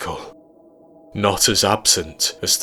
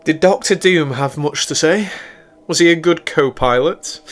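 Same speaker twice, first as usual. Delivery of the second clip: high, loud, playful woman's voice; Celine's teasing voice; 9 semitones higher, 5 dB louder than usual. Acted. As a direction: tease a crewmate in a raised pitch.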